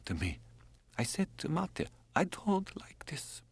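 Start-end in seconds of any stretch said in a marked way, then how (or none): 1.0-1.9 much higher, tearful woman's voice breaking into whispers; a dying woman confiding her fears, voiced high and fragile; Drenka's voice. Narrator voices Drenka at length. 2.2-3.4 tearful whisper. Acted. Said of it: "I was crying".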